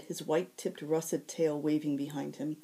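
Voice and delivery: ordinary delivery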